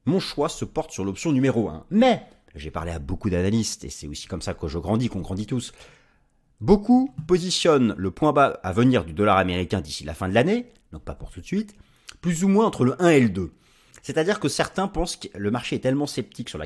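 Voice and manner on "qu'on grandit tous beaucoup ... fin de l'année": ordinary delivery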